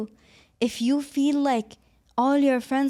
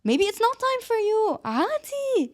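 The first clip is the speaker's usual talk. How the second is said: high emphatic reassurance; 9 semitones higher than usual. Real, not acted.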